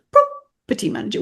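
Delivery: "property manager" jumping high on PROP; coaching "property manager" with a high-pitched PROP; stress on first syllable. Shown as a demonstration. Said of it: "We jump on the first one".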